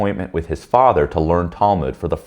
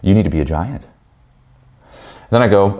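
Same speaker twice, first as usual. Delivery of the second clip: usual speaking voice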